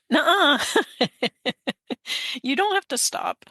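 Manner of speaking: high teasing nuh-uh with a laugh; a cheeky friend's high, laughing retort; playful mock scolding. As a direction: wag a finger at her in fun. Friends remind her, acted quote.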